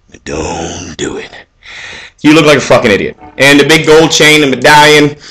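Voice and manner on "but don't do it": slow whispered aside. Real, not acted. Warning viewers off the shirts, slow whisper.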